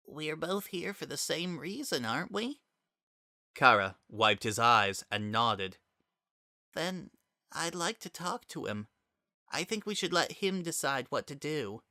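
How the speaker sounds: quiet, tender kind voice; calm a grieving friend softly; soft, gentle reassurance. Acted. Frisk gently proposing.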